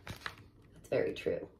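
soft, slow whispered agreement; whispered aside. Acted. Reader whispers agreement to the kids. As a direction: confide it quietly.

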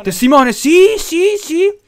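high, excited, comic begging; mock pleading. Gamer begging witnesses to report him, excitedly. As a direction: urge them on in a loud high voice.

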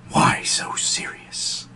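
slow, whispered menacing taunt; Joker. Acted. Joker quoting his father over Mommy, sinister whisper. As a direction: draw it out in a breathy whisper. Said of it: "and he says".

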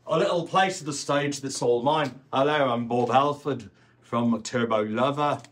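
put-on Birmingham accent; playful Brummie impression of a rock singer. Tribute singer introducing himself as his idol, in a Birmingham accent. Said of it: "my Birmingham accent".

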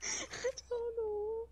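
slow, through laughter; laughing. Real, not acted. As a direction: laugh helplessly while answering. Laughing after repeated deaths.

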